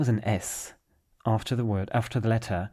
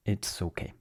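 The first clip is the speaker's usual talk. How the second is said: ordinary talk, nothing marked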